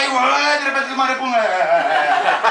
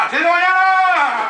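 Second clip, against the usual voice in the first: loud high slow outraged yell; outraged shout; 10 semitones higher, 5 dB louder, slower than usual. Retold or imitated. Father complains he must rise early, loud slow outraged yell. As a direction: bellow the time like an insult.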